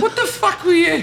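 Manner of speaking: high, loud, laughing disbelief; incredulous. Retold or imitated. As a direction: laugh it out in shock. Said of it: "He's like".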